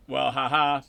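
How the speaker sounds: dry, sarcastic "ha-ha". Acted. Mocking a commenter's joke with sarcasm. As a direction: say "ha-ha" like you don't find it funny. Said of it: "a bit of sarcasm in ha-ha".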